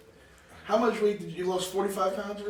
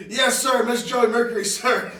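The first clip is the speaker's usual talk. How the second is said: shouted "yes sir" answer; loud eager recruit; 7 semitones higher, 11 dB louder than usual. Retold or imitated. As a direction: bark it like a rookie. Retelling Knuckles answering Joey.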